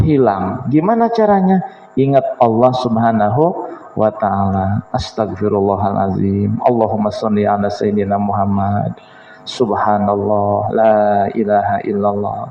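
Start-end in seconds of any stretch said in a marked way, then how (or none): 2.0-12.4 calm remembrance. Shown as a demonstration. Preacher models remembering Allah calmly.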